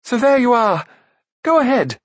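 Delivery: very high, loud bravado; plucky prince braced for it, high and loud; stout defiance. A narrator's reading. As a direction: dare her to do her worst. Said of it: "he faced Akron stoutly".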